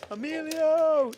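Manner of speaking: calling out. Retold or imitated. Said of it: "And I was like".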